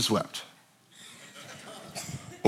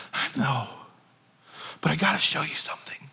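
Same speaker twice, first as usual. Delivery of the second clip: soft reassurance; 2 semitones lower, 8 dB quieter than usual. Acted. Jesus comforting softly.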